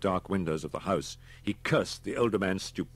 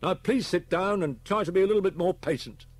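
high, loud, firm rebuke; exasperated policeman; 13 semitones higher, 8 dB louder than usual. A narrator's reading. Policeman urges Homan to be patient, loud and firm. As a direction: tell them off forcefully.

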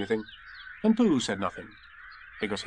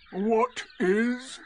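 higher, doubtful donkey voice; Eeyore; 10 semitones higher than usual. A narrator's reading. Donkey querying the newcomer, high and doubtful. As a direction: question it dubiously, pitched up. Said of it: "said Eeyore".

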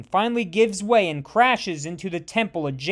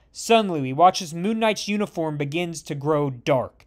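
usual speaking voice

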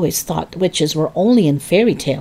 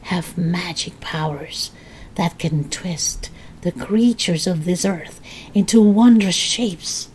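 throaty witch whisper, slowing down; old woman whispering. Acted. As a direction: hiss the secret like a witch. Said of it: "whispering in a throaty sort of voice".

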